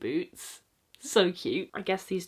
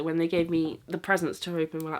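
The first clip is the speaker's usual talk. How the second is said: ordinary delivery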